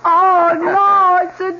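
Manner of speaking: very high, loud squeal of joy; delighted squeal. Acted. Wife seeing the dog husband brought, squealing with joy. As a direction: squeal over the puppy, drawn out.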